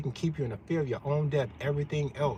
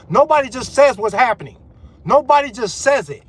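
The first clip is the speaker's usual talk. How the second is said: angry shout; 7 semitones higher, 10 dB louder than usual. Real, not acted. Heated rant, nobody speaks truth.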